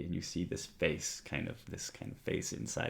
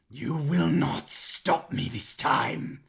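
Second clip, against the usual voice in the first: high, loud, slow raging shout; furious defiance; 10 semitones higher, 13 dB louder, slower than usual. Acted. Villain Anishtala defies the Thorn Mother, raging shout. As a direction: scream it at your enemy.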